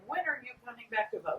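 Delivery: high-pitched, pleading mom on the phone; motherly nagging. Retold or imitated. Mom on the phone with her son, nagging.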